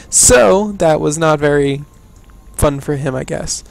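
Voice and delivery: ordinary delivery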